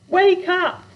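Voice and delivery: high loud shout. A narrator's reading. Girl shouting at her sleeping parents, high and loud. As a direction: yell it up high to wake them. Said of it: "she shouted".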